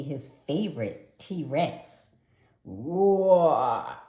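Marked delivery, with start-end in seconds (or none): 2.6-4.0 loud dinosaur roar. A narrator's reading. Froggy plays a T-Rex, a loud roar. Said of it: "cried Froggy".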